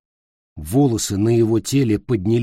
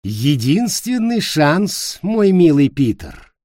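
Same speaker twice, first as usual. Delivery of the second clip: slow, higher, seductive witch; femme fatale; 5 semitones higher, 3 dB louder, slower than usual. Acted. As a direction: tempt him, slow and sweet.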